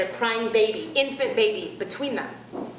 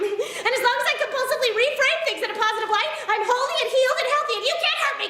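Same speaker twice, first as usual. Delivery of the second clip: high-pitched, chirpy self-help zealot; mock the relentlessly positive believer; positive-thinking zealot; 12 semitones higher, faster than usual. Comic mocking positive-thinking people, high chirpy voice. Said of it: "Those people are always like".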